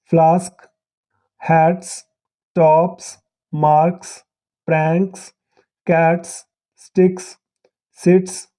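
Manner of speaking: each word said alone, the last "स्" in "वर्क्स" and "कैट्स" a crisp s; slow, careful word list with the ending hissed as "स"; final s as s sound. Shown as a demonstration. Teacher to students, modelling the final s as a clear s sound. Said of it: "एस जब स का sound पैदा करता है".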